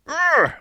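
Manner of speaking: high, loud frustrated groan. Retold or imitated. Husband recalling being told he's defensive, exasperated ugh. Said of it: "sometimes I get mad".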